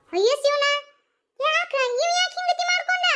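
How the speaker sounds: high keening cry; shocked relative wailing over him; wailing lament. Acted. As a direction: wail it out high to the heavens. Woman wails at the news.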